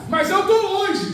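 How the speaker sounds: high, drawn-out cry of objection; distant believer protesting. Acted. Distant believer answering Jesus, high anguished protest.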